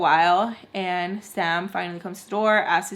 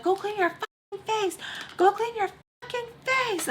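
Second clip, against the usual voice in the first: mad at her; 12 semitones higher, 3 dB quieter than usual. Retold or imitated. Friend to bleeding woman, mock-furious order. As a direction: imitate a furious squealed order. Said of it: "so mad at me".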